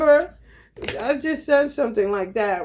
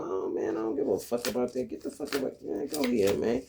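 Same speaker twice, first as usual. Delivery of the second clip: much softer, muttered brush-off; dismissive mutter; 9 dB quieter, faster than usual. Retold or imitated. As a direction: wave it away under your breath. Quoting a dismissive attitude.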